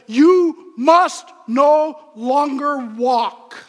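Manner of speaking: loud, slowed, every word punched; emphatic command. Acted. Preacher reading Paul's command to the church, loud and slow. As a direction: hammer out each word of the command. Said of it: "He begins with this emphatic command".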